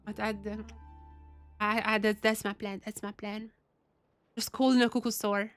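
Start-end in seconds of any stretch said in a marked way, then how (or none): none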